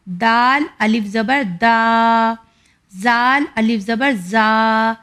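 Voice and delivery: slow recitation drill, each aa drawn long; stretched long vowels. Shown as a demonstration. Teacher drilling alif madd for children, slow stretched aa. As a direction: chant each letter with a long aa. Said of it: "یعنی ہمیں اتنا کھینچنا ہے".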